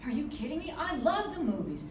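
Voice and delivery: ordinary delivery, nothing marked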